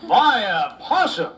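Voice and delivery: slow, high, incredulous echo with a laugh; disbelief. Acted. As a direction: repeat it as if you can't believe it.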